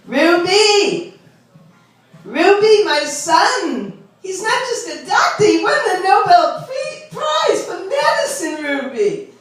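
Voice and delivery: high-pitched bragging mother; high, swooping Long Island brag; beauty parlor lady. Acted. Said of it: "this is what she hears".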